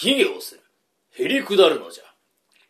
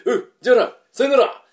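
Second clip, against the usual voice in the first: loud farewell; 9 semitones higher, 8 dB louder, faster than usual. Acted. Sekitori sends a visitor off.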